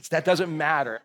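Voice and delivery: normal talking voice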